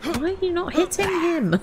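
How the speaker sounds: high, exasperated; exasperated squeal. Real, not acted. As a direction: squeak it out in disbelief. Frustrated at game character.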